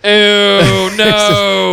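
long drawn-out high groan of revulsion; mock disgust. Co-host recoiling at a crude joke, drawn-out mock disgust. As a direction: stretch it out, grossed out and playful.

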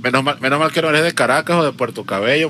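ordinary talk, nothing marked

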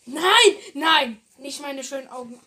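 loud protest. Acted. Mock protest at hair removal.

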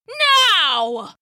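loud commanding shout. Acted. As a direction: bellow the order like a goddess. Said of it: "Athena shouted".

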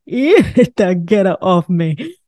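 slow "Ew", laughing through "get it off me"; laughing disgust. Retold or imitated. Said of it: "I was like".

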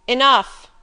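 loud sudden call across the room. Acted. Master calling out to stop the piano player, loud. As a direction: stop her at once, loudly. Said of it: "he called out".

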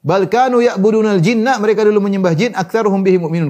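high, emphatic recitation and translation; impassioned preaching. Acted. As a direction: drive the verse home from the pulpit.

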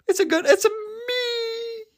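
very high, stretched-out squeaky voice; silly squeal. Pretend. Host goofing after laughing fit, silly high squeal.

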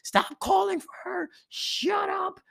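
high, loud, drawn-out angry shout; yelling dad. Retold or imitated. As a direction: imitate a fed-up father yelling through the house. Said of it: "my dad's like".

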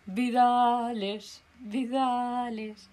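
slow, stretched calling of Vidales; calling out his name, dragging it out; drawn-out calling. Real, not acted. Fan calling out to the driver, drawn-out call.

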